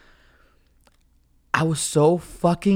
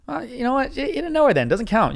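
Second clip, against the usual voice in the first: high-pitched put-on argumentative voice; mock dismissive reply; 8 semitones higher than usual. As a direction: brush off an imagined complaint in a raised pitch. Imagined reply to cousin's wife.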